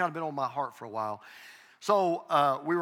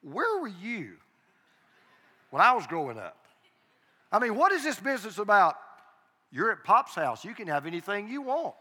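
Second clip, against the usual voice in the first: mock complaint; 5 semitones higher, 4 dB louder than usual. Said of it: "and they'll say".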